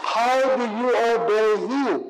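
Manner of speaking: usual speaking voice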